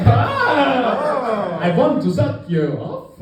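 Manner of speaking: drawn-out high cry into lewd vampire voice; horny Dracula. Acted. Comic debuting his vampire character, hammed-up horny Dracula. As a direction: ham up a lustful vampire with a Transylvanian accent. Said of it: "that's horny Dracula".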